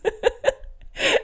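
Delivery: airy giggle; breathy laugh. Real, not acted. Laughing at own joke.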